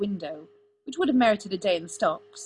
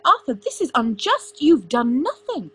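high, loud, urgent objection; heated pleading, pitched well up; indignant protest; 6 semitones higher, 10 dB louder than usual. A narrator's reading. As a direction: protest the injustice, voice raised. Merlin protests an unjust flogging.

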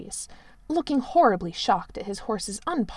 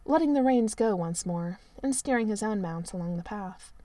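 plain reading voice

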